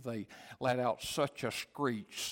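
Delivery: normal talking voice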